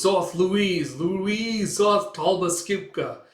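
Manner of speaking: high, drawn-out otherworldly call; eldritch incantation. Acted. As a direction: intone it like a strange summons. Creature's incantation in the story.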